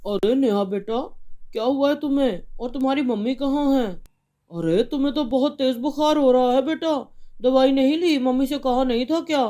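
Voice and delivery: a father finding his feverish daughter; worried father. Acted.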